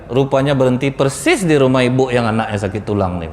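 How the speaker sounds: ordinary delivery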